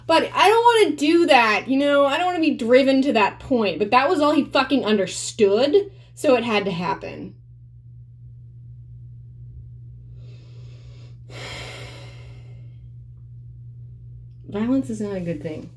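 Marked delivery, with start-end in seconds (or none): 0.1-7.3 heated. Real, not acted. Heated outburst about violence. 8.2-14.4 long heavy sigh. Real, not acted. Long sigh after story.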